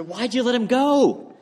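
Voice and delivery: high, rising, incredulous question; dismayed outcry. Acted. Pastor challenging the man who let Jesus leave, in dismay. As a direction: climb sharply in pitch as you plead.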